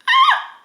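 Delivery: high, loud startled squeal; caught-out squeal. Retold or imitated. Host acts out the woman caught in his sweater, squealing.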